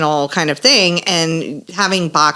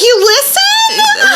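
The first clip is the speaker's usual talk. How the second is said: very high, slow drawn-out You listen?; sing-song fake astonishment; mock surprised; 4 dB louder, much slower than usual. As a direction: feign shocked delight. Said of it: "And I'm like".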